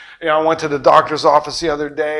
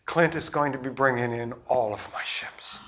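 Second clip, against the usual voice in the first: whispered reveal; 3 semitones lower, 9 dB quieter than usual. Retold or imitated.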